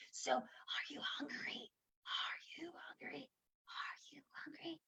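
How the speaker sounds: slow, breathless whisper. Shown as a demonstration. Teacher shows the volume game in a hush. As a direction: whisper it as if sharing a secret. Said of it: "and I was very silent".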